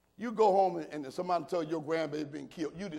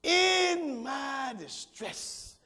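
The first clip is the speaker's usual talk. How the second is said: preached shout; 6 semitones higher, much slower than usual. Shown as a demonstration. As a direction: hit it hard so it sticks with the congregation. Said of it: "If you don't get nothing else, put that somewhere".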